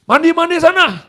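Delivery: high, sharp mock yell; snappish father. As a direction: imitate a dad's curt, high-pitched order. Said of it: "Dia kalau ngomong nyolot begitu".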